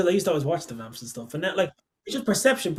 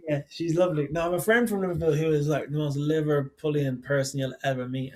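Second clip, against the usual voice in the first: normal talking voice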